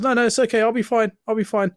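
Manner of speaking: high-pitched imitation of brushing it off; retold hasty reassurance. Hit man reassuring the driver, retold hasty reassurance. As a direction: insist high, mimicking the victim waving help away.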